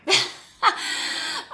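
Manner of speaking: high breathy laugh through tears; a high unvoiced giggle while tearing up; teary laugh. Real, not acted. Laughs at crying on day one.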